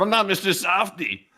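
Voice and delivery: high put-on voice; high, mocking character voice; caricature voice. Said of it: "I'm the king of that voice".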